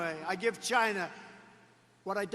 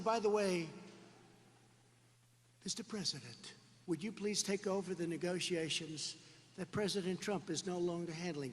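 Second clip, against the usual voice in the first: mock soft request; 5 semitones lower, 9 dB quieter than usual. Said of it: "how about saying".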